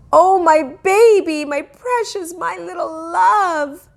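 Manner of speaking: put-on high, loud, cooing over her hurt baby; doting mother. A narrator's reading. Mother cooing over her injured toddler, high and tearful. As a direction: gush over the child like a frantic mom. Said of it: "She kissed him all over".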